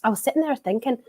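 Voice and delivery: bad, light Scottish accent; a weak stab at a Scottish pundit accent; self-mocking half-hearted Scottish accent; Charlie Nicholas Scottish accent. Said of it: "I just did a Charlie Nicholas".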